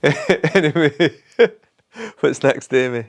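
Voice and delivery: laughing while talking. Real, not acted. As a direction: laugh through the words. Laughing off his hiccups.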